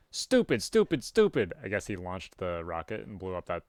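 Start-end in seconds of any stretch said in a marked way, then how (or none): 0.1-1.5 high, scolding himself with each "stupid"; self-berating. Acted. The character scolds himself, high and frustrated. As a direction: hammer each "stupid" at yourself. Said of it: "berate self for unauthorized demolition".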